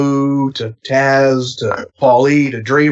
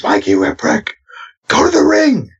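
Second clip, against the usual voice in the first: Paul calling out; 11 semitones higher than usual. Retold or imitated. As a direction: call him to the ring, getting impatient. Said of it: "Paulie goes".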